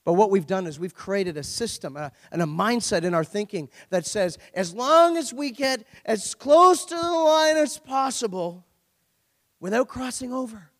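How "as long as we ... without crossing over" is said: emphatic preaching. Real, not acted.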